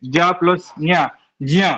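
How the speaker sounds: जा प्लस न्या said slowly, then fused as ज्या; step-by-step conjunct demo; joining ja and nya. Shown as a demonstration. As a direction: spell out जा and न्या, then blend them into ज्या. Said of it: "इसको जब जा से जब जोड़ेंगे".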